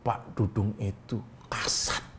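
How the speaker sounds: slow, unvoiced whisper, very soft; hushed whisper. Real, not acted. Co-host confiding the general's rank to partner, whispering. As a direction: whisper it slowly like a secret.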